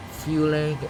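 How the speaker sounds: usual speaking voice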